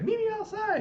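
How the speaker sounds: very high squeaky threat; mock challenge. One fighting girl to the other, high mock challenge. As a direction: imitate a girl calling out her rival.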